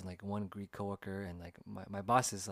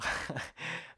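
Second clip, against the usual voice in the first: high-pitched chuckle; amused laugh; 7 semitones higher than usual. Real, not acted. Storyteller recalling the Greek coworker's reaction, amused laugh. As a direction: chuckle at your own story.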